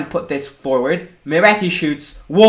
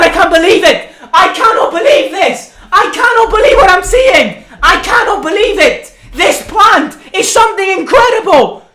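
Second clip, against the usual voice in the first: high, loud screaming goal reaction; ecstatic disbelief; 10 semitones higher, 5 dB louder than usual. Real, not acted. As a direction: yell in stunned, elated disbelief, voice pitched way up. Streamer reacts to own goal live.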